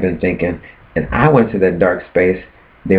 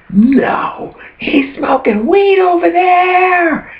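very high, slow falsetto tattling; tattling squeal; much slower than usual. Pretend. Tattling on the weed smoker to everyone, very high squeal. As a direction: squeal it out like a kid telling on someone.

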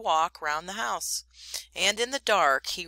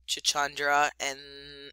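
plain reading voice

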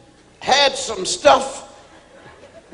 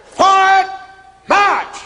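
high, loud shouted command; drill command; 10 semitones higher, 6 dB louder, slower than usual. Retold or imitated. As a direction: yell it across the parade ground.

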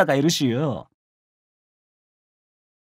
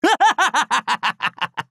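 high cackle falling away; hearty laugh; 6 semitones higher than usual. Acted. Warrior delighted with his master, hearty cackle. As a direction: burst into a delighted, descending laugh.

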